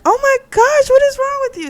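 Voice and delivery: very high, loud mock outraged squeal; shocked shriek. Woman to the man after he smacks her, high shocked squeal.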